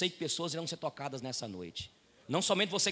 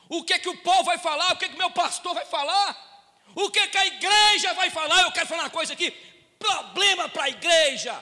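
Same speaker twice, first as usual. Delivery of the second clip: raised, ringing pulpit voice, higher and louder; fiery preaching; 9 semitones higher, 10 dB louder than usual. Acted. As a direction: preach it out to the whole church. Pastor preaching at full force.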